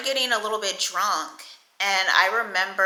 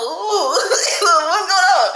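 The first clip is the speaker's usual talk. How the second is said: laughing confusion; 10 semitones higher, 7 dB louder, much slower than usual. Retold or imitated. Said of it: "I'm like".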